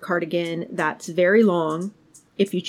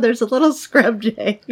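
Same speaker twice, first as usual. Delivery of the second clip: giggling through the words; can't stop laughing; 4 semitones higher, 5 dB louder than usual. Real, not acted. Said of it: "I can't help but laugh out loud".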